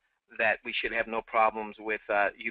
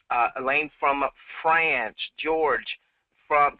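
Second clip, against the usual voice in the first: normal talking voice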